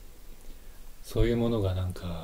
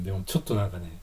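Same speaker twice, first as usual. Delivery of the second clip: ordinary delivery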